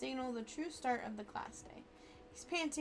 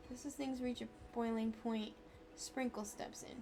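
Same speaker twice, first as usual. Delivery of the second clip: ordinary talk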